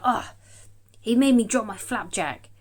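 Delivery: a hint of an annoyed wife; wife's complaint.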